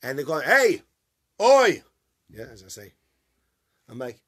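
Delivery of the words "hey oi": loud, high hey, oi across the street; calling out. Retold or imitated. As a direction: call out to a passer-by. Strangers calling out to him.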